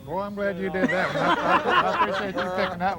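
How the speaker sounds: laughing gratefully; words bubbling with laughter. Real, not acted. Laughing at the long question.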